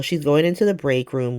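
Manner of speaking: usual speaking voice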